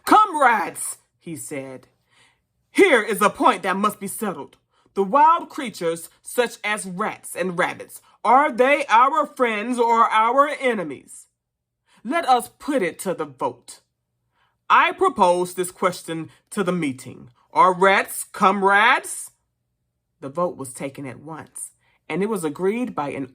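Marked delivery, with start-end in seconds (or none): 0.0-1.0 high, loud call to the crowd; calling for silence. Acted. Raised his charter for silence. 2.7-19.3 emphatic chairman putting a motion; orator pig Major. Acted. Major puts a question to meeting.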